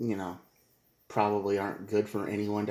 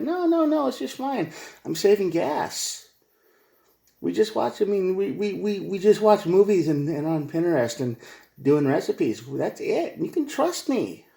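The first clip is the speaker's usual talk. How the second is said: much higher, sing-song innocent voice; mock girlfriend excuse; 9 semitones higher than usual. Girlfriend insisting she's saving gas, high mock innocence. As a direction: sell the lie, all innocence.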